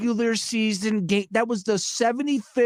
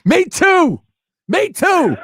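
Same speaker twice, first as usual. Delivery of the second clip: excited laughing; 12 semitones higher, 9 dB louder than usual. Real, not acted. Laughing agreement with co-host.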